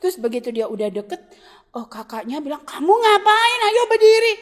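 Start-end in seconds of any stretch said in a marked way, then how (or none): 2.7-4.3 loud, high call telling him to stand up; high, loud older-brother call. Acted. Brother voiced in Bible story.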